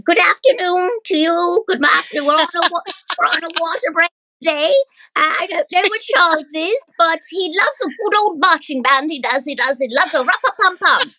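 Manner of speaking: high, posh royal lady voice; Queen Elizabeth. Pretend. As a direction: play the Queen, high and regal. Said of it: "one of them was the Queen".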